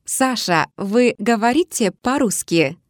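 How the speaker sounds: usual speaking voice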